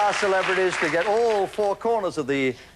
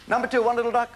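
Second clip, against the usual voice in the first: bingo caller; 7 semitones higher, faster than usual. Acted. Bingo number call, shout.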